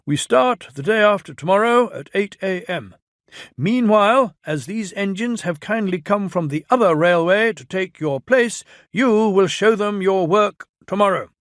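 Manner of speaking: Fat Controller voice. Acted. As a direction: announce it like a stern boss.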